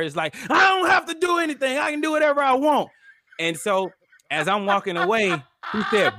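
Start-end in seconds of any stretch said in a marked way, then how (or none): 0.5-2.9 high, loud mock tantrum; yelling old man. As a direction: yell it like a defiant old man. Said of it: "yelling at her".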